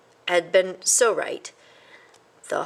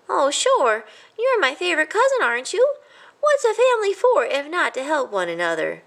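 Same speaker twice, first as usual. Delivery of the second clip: high, cheerful mouse voice, a bit louder; happy mouse; 10 semitones higher, 4 dB louder than usual. A narrator's reading. A cheerful cousin offers help, high and happy. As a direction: chirp it brightly. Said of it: "said Squeak happily".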